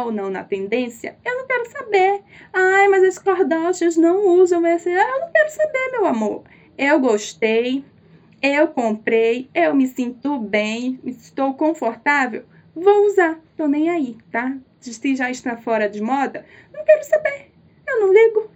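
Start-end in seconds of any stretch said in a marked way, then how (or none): 1.2-2.2 higher, mock-defiant squeal. Vlogger brushing off fashion rules, high sassy defiance. 2.5-4.9 mock critic. Mocks imagined fashion critic. 4.9-6.4 much higher, sassy mock refusal; sassy defiance. Vlogger answering fashion critics, high diva sass. 16.7-18.5 much higher and louder, mock-wailing refusal; half-wail it in high dramatic defiance; shrieking defiance. Vlogger refusing fashion trends, high louder mock wail.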